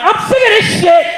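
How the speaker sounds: high, loud frustrated outburst; mock fed-up yell. Inventor fed up with bad meatloaf, mock angry outburst. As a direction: blow up at the ruined meatloaf. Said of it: "some guy went".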